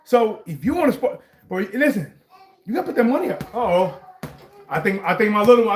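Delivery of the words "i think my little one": normal talking voice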